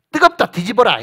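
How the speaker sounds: a touch of hollering; acted impatient order.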